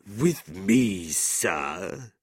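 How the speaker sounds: put-on scornful drunk; drunken scorn. A narrator's reading. Drunk gentleman answering a young challenger, drunken scorn. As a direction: sneer it back like a drunkard. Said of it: "looking at Nicholas with drunken scorn".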